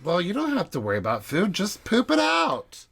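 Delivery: breezy mock advice, last words pitched high; mock Erica's advice. Cohost mimicking Erica advising on food worries, mock breezy. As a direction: toss it off, leaping up and stretching the ending. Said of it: "Erica's like".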